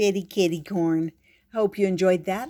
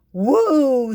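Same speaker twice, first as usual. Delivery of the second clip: loud, high whoop of admiration; impressed whoop; 6 semitones higher, 9 dB louder than usual. Acted. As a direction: cheer like you can't believe it.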